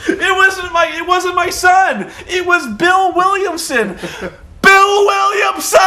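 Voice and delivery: mock yelling. Parents yelling at the news camera, hammed-up shrill shout. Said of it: "start yelling at the camera".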